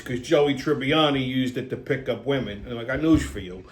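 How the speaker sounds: ordinary talk, nothing marked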